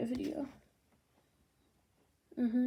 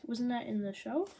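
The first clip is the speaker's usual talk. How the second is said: ordinary talk, nothing marked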